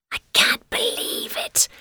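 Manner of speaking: breathless awed whisper; hushed amazed whisper. Acted. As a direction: whisper it to yourself in wonder. Blossom amazed alone.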